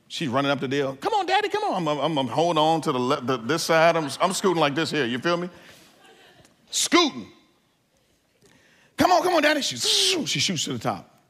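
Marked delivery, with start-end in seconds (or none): 1.0-1.9 put-on much higher child voice urging; eager little girl. Daughter urging scared dad up the zip line stairs, high child voice. 9.0-9.6 put-on much higher child voice urging; eager little girl. Daughter urging scared dad up the zip line stairs, high child voice.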